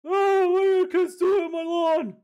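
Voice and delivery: put-on high, loud shouting old man. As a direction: yell at kids off your lawn. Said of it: "That voice is horrid".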